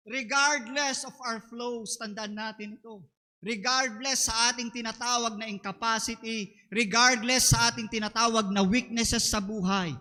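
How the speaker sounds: ordinary talk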